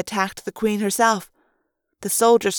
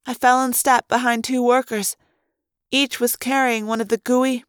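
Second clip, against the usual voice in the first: plain reading voice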